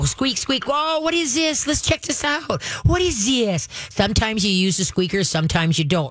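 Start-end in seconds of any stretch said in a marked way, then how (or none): 0.0-3.7 much higher, happy curious coaxing. Shown as a demonstration. Trainer showing the owner the curious lure, higher and happy. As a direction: sound delighted by what you found. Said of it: "your voice has to be high pitched and happy".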